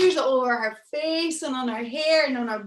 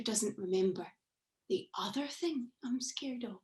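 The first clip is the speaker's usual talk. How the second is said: hushed, unvoiced fearful aside; worried whisper; 8 dB quieter than usual. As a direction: whisper it so the monster won't hear.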